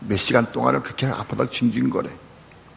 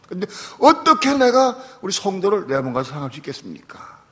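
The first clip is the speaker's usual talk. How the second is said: pleading prayer rising higher and louder; anguished prayer; 5 semitones higher, 3 dB louder than usual. Retold or imitated. Pastor praying after yelling at his son, anguished plea. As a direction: cry out to God in anguish. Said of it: "나 기도했어요".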